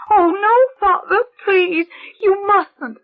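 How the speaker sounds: very high, crying plea, the second part slow; pleading child. Acted. Girl begs the priest not to speak, very high and crying. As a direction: beg in panic through tears.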